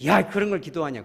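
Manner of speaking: loud, high rebuke; a sharp mock reprimand; mock scolding God. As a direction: bark it like a stern scolding. God imagined scolding the pray-er.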